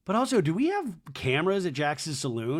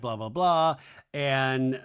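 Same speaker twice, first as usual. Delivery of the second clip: normal talking voice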